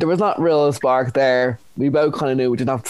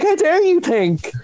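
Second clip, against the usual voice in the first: high-pitched, hammed-up outrage bubbling with laughter; indignant retort; 12 semitones higher than usual. Dumped dater scolding the farmer over his text, mock outrage. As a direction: tell them off in mock fury, pitch climbing.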